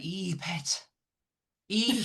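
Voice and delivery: a broad, hammed-up Geordie Ee, pet; mock Northeast accent. Mocking the canoe show's Northeast accent for friends. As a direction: lampoon the Northeast accent from the TV show. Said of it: "the accent".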